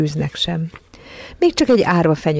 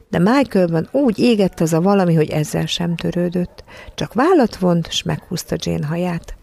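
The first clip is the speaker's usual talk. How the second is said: ordinary delivery, nothing marked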